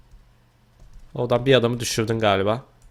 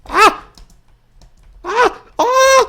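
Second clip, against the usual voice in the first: much higher, loud yelps; high scream; 8 dB louder, much slower than usual. Real, not acted. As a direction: shriek in panic. Near falling in game.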